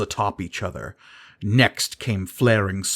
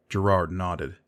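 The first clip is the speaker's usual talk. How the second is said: plain reading voice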